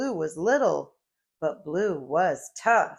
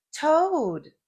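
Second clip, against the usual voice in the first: calling out; 8 semitones higher, 4 dB louder than usual. Acted. Blue calling Toad.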